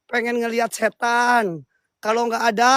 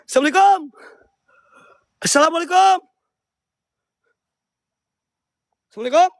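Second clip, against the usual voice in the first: spirit invocation; 6 semitones higher than usual. Acted. Ghost hunter greets and invokes the unseen spirits, higher and ritual. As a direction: call each spirit name like a rite.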